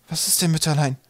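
put-on mildly agitated boy's plea; agitated question. A narrator's reading. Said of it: "fragte er aufgeregt".

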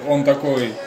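ordinary talk

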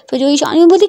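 plain reading voice

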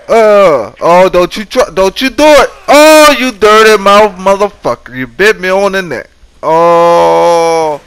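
high, loud cries as he gets bitten; panicked screaming. Real, not acted. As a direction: shriek while the zombie bites you.

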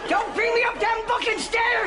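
slow high shout; angry shout. Acted. Mother yelling at daughter upstairs, slow high shout. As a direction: bellow it up the stairs.

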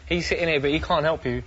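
high, loud gleeful ribbing; mocking taunt. To the rival fighter, jeering that his promoter cannot help. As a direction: lay it on thick, enjoying the dig.

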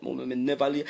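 normal talking voice

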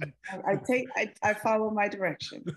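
laughing through the words; light real laughter under the line. Real, not acted. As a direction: giggle while owning the compliment. Teasing reply about taking notes.

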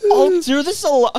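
high, loud, in the wrong character voice. Acted. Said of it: "no, wrong voice".

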